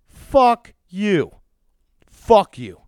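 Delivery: raging scream straight at the enemy; furious shout. Real, not acted. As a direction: yell it at the radio with everything. Furious rant at radio host.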